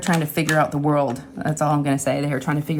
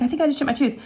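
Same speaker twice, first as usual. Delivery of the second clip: worried; 8 semitones higher, faster than usual. Retold or imitated. Retold alarmed self.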